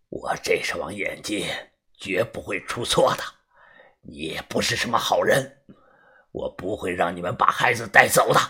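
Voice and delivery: low, hushed rasping warning; a wary old drunk, half whispered; hoarse drunk man. A narrator's reading. Drunk man accuses narrator.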